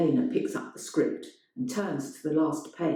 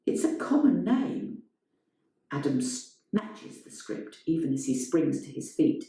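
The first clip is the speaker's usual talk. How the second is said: ordinary delivery, nothing marked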